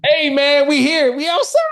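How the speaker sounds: loud, high hype-man call; hyped shout. Real, not acted. Friend shouting back that he's still on the call, hyped. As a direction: bounce it out, pumped. Guest hyped, answering the host.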